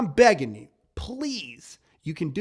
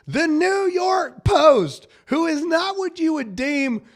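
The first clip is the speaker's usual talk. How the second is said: outraged shout; 11 semitones higher, 8 dB louder than usual. Real, not acted. Host yelling at his audience in outrage. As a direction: explode in disbelief at the news. Host erupts over news story.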